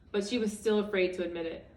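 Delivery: usual speaking voice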